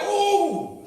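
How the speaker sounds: high loud mock ooh of delight; a hammed-up wow at the spicy bite; high, loud mock amazement; impressed ooh. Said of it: "He was like".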